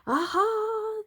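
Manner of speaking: high, loud triumphant cheer. A narrator's reading. Robbers cheering over the stolen horse. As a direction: cheer like you've won. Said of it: "they cheered".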